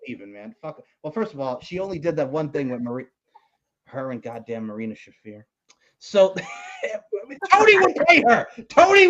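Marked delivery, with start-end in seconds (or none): none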